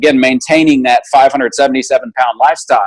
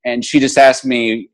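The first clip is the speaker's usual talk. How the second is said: usual speaking voice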